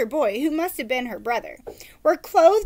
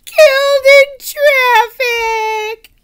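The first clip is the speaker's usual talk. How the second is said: mock bawling; 12 semitones higher, 14 dB louder, much slower than usual. Girl keeps bawling.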